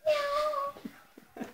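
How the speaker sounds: high, thin kitty meow; playful high meow; cat meowing. Acted. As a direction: mew like a little cat. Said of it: "Ooh, cat".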